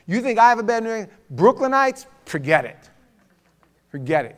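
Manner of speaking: loud Brooklyn-style New York accent; punchy New York brush-off. Shown as a demonstration. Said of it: "he would have the worst New York accent".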